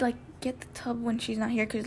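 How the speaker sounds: ordinary talk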